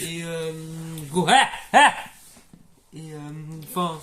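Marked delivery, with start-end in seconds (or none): 1.1-1.9 cri soudain et fort; lâche le tic brusquement. Acted. Tic de Tourette joué. (cri de tic de Tourette)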